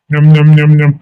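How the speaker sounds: hearty nom-nom chewing noises; pretend munching. Child finishing food from mother, munching. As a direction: chomp on the last mouthful.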